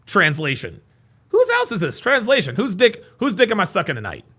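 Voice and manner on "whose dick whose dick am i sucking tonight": ordinary talk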